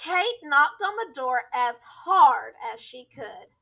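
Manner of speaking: ordinary delivery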